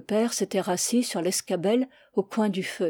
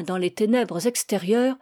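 plain reading voice

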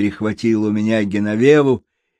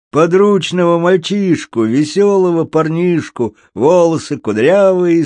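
higher, lilting rhymed call; rhyming showman; 6 semitones higher, 4 dB louder than usual. Acted. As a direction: chant it out to a crowd. Puppeteer's rhyming public appeal.

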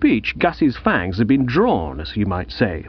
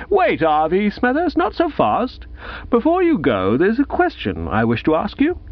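put-on higher-pitched, halting the boy with authority; Gussie, overbearing prize-giver; 9 semitones higher than usual. Gussie stops the departing schoolboy to question him, high and officious. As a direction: play a self-important prize-giver in a raised pitch.

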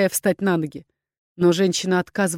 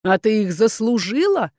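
understated sneering questions; contemptuous echo of the plea; scornful; slower than usual. A narrator's reading. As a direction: throw it back with a curled lip. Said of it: "скривил губы Рэй".

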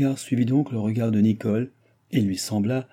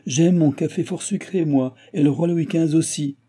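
higher, insistent self-justifying retort; indignant old baron; 8 semitones higher, 4 dB louder than usual. Acted. Baron defending his sugar to his son, indignant. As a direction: insist on it with raised pitch.

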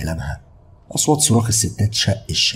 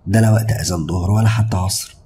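ordinary delivery

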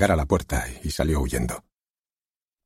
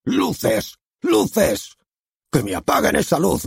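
shouting for lights; 11 semitones higher, slower than usual. Acted. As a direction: yell it to the booth.

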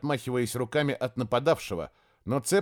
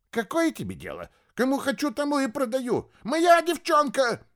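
frantic scream; 13 semitones higher than usual. Acted. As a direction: scream it in panic. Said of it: "закричал истошным голосом посланник".

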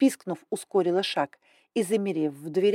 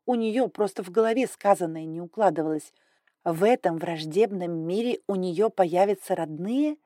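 ordinary delivery, nothing marked

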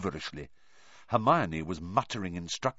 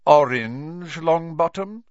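stern teacher; 10 semitones higher, 7 dB louder, slower than usual. Acted. Teacher scolding a pupil.

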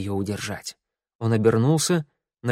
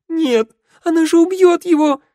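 terrified scream; 9 dB louder than usual. Acted. Girl sees shadow seize friend.

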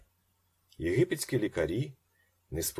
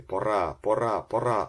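raised, urgent repeated call; attempted shout; 3 semitones higher, 6 dB louder than usual. A narrator's reading. Ben tries to signal the landing moment, urgent cry. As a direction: push each word out louder, rising. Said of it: "Він намагався крикнути".

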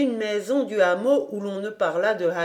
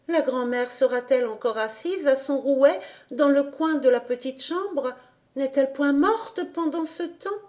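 put-on higher child voice, anxious; a small girl fretting as she climbs; young girl, worried inner voice; little Heidi; 6 semitones higher than usual.

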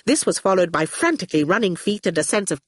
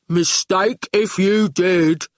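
slow, heavy goon voice; 3 dB louder, slower than usual. Acted. Goon stands over Howard, slow reproach. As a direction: drag the words out as a lumbering heavy. Goon answers Awful bluntly.

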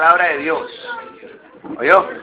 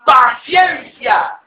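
slow emphatic answer; 6 semitones higher, much slower than usual. Retold or imitated. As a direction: stretch each syllable of the answer. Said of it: "no, dice".

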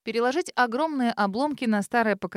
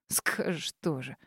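snorting dismissively; 8 semitones lower, 8 dB quieter, slower than usual. A narrator's reading. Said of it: "фыркнул Харитон".

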